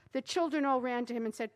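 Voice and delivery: ordinary delivery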